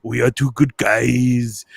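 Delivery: dumb, hammy Austrian strongman voice; slow, drawn-out bad Austrian accent; Arnold Schwarzenegger. Introducing his buddy in the old bit, hammy Austrian impression. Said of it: "So it'd always be like".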